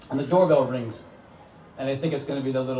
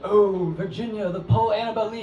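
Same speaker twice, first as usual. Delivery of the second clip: gushing fan; 7 semitones higher, 3 dB louder than usual. Retold or imitated. Excited fan gushing over Poe.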